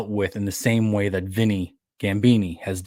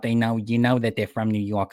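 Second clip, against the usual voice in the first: put-on heavy New York accent. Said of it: "'cause they sound like this".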